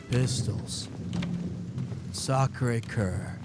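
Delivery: low, deadpan Keanu voice; Keanu impression. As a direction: drop it low and flat like Keanu Reeves. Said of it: "my, uh, Keanu impression".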